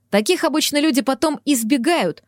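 usual speaking voice

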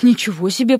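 plain reading voice

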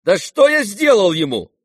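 high, loud protest; indignant cry. Acted. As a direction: cry out in outraged complaint. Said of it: "вскричал Ипполит, скаля зубы".

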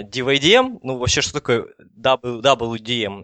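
ordinary delivery

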